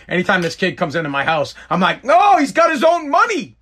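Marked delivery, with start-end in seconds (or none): 2.0-3.6 high, loud hollered catchphrase; over-the-top high-pitched shout; movie quote impression. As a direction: holler it like a film character. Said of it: "because coming to America".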